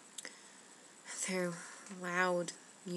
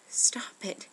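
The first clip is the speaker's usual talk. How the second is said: louder, brief cry of protest; restrained scream; 7 dB louder than usual. Acted. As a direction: call out sharply without fully screaming. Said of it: "I started screaming".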